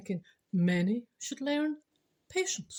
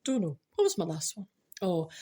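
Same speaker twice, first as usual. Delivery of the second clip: usual speaking voice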